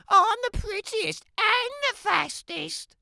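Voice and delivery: put-on a sweet, self-admiring child's voice; vain little girl. Little Red voiced by narrator.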